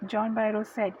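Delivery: ordinary talk, nothing marked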